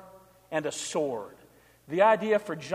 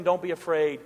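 usual speaking voice

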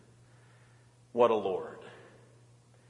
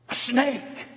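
higher, a mock-startled "A snake!"; spring the snake on them like a surprise; surprised reveal; 7 semitones higher than usual. Pastor reveals snake in story.

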